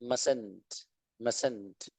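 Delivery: "mustn't" said as "mus-unt", soft and low. Shown as a demonstration. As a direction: repeat "mustn't" with the t silent before the n. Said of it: "उसको mustn't पढ़ते हैं".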